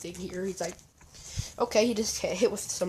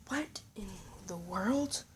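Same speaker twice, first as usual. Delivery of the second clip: softer, voiceless whisper of disbelief; stunned whisper; 10 dB quieter than usual. Acted. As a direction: whisper it in awe. Deku sees Santa's power.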